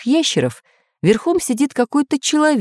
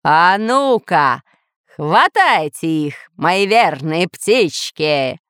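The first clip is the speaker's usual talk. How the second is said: slow, drawn-out attack order; menacing, savoured command; commanding villain; 2 semitones lower, much slower than usual. Acted. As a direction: set your creatures on them, stretching words. Villain orders his birds to attack.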